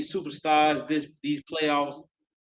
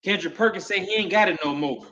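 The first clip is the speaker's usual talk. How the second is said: ordinary talk, nothing marked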